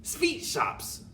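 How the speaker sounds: high shouted announcement; witch's shout. Acted. Grand High Witch shouting the plan to her witches, high. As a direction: shout the answer at them. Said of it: "shouted the Grand High Witch".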